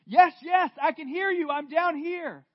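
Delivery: high, loud shout upward; desperate calling out. Acted. Jack shouts up to the unseen voice, loud and high. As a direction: yell back in relief.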